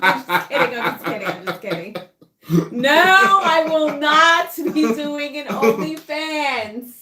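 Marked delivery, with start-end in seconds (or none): 2.7-6.9 high, loud and slow no; emphatic slow refusal; firm loud refusal. Real, not acted. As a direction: lay down the law, laughing it off. Firm refusal after joking.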